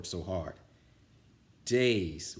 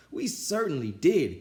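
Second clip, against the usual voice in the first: cried out; 7 semitones higher, 5 dB louder than usual. A narrator's reading. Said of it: "cried all the kids".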